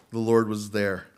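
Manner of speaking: normal talking voice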